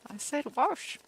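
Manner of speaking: mock grandma defending "wash"; grandma's retort. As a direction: playfully insist like an indignant grandma. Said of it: "And she's like".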